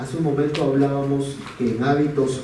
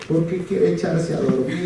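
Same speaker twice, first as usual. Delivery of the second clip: higher, lightly voiced little boy; mock child tattling; childish complaint; 6 semitones higher than usual. Son telling his father why mom won't go, childish voice.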